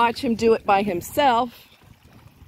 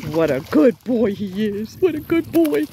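doggy praise voice. Acted. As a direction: coo at the pup like a proud mom. Praising dog again.

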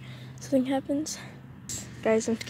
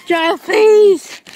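loud high begging; desperate begging; 8 semitones higher, 12 dB louder than usual. Real, not acted. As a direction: plead with all you've got. Begging when caught.

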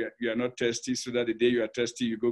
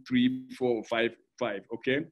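usual speaking voice

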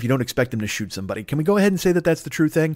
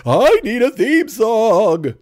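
slow, higher and louder, drawn-out begging; desperate plea; 8 semitones higher, 5 dB louder, much slower than usual. Pretend. Host begging his friend for a theme song, slow and pleading. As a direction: stretch it out like a last-minute panic. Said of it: "come sneaking up on him ... and go".

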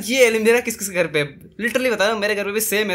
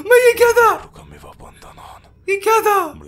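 much higher, loud shaken cry; shocked shriek; 14 semitones higher, 9 dB louder than usual. Real, not acted. Player just caught by the ghost, high shocked cry. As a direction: blurt it out still terrified. After the scare.

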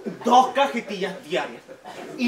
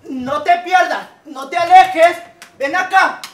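high shouting for a dog; calling out; 9 semitones higher, 9 dB louder than usual. Acted. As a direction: call out across the park. Owner calls for lost dog.

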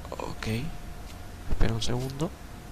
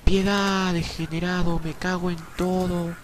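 high-pitched cries for mercy; desperate, high gamer panic; panicked begging; 7 semitones higher, slower than usual. Real, not acted. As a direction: wail and plead as you're caught. Caught in game, begs for mercy.

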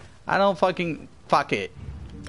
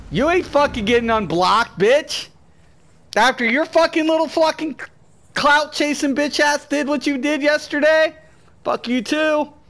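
higher, scornful tirade; real angry berating; 6 semitones higher than usual. Real, not acted. Streamer berates a banned viewer over yesterday, angry. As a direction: lay into them with raised pitch.

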